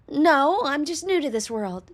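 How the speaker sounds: high, cutesy character voice; cute girl voice. Acted. Slime girl liking her first house, high and cute. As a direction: pitch it up, cute and curious. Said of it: "Wobbly, high-pitched, and very cute".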